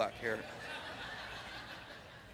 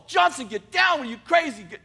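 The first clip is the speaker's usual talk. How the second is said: higher, much louder barked order; sergeant shouting; 8 semitones higher, 8 dB louder than usual. Retold or imitated. Sergeant yelling at Johnny under fire, a loud frantic shout. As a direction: yell at him to get down. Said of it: "The sergeant's all".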